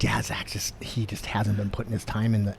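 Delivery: hushed, half-whispered retelling; confiding. Retold or imitated. Passing on a fighter's doubts about Zach, quietly confiding. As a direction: keep it under the breath, reluctant to say it.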